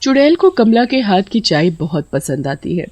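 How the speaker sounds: ordinary delivery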